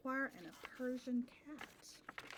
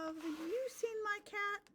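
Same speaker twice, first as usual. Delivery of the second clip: lightly voiced boy, higher; high question; 9 semitones higher than usual. Boy asking a stranger about his cat, higher. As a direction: ask it like a hopeful child.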